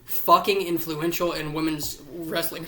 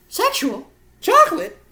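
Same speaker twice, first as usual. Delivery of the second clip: much higher, louder hyped call; excited callout; 13 semitones higher, 8 dB louder than usual. Real, not acted. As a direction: belt the nickname like a hype man. Calling out Mark Henry's nickname.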